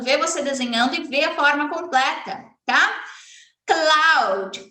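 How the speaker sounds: ordinary talk, nothing marked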